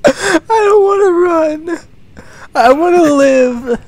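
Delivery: high, slow, loud crying wail; begging for life in real fear; terrified wail. Real, not acted. Being chased, refusing to run.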